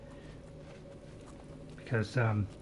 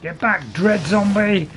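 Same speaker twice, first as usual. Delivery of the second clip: heroic shout; 8 semitones higher, 9 dB louder, slower than usual. Acted. Commanding a foe in game.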